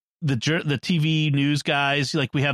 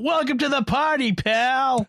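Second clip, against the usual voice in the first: mock yell; 8 semitones higher than usual. McClane to Al driving away, mock yelling. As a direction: imitate an action hero calling out. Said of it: "McClane yelling out".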